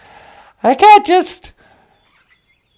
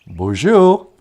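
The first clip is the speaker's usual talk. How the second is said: puppet voice; 12 semitones lower than usual. Acted. Second puppet voice greeting.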